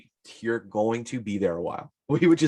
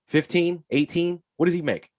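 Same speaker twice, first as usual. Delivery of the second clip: ordinary talk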